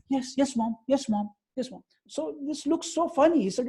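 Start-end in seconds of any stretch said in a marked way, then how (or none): 0.1-1.8 imitated higher-pitched, dutiful replies; obedient child. Revered swami answering his mother's call, mock obedient child. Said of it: "Like an obedient child".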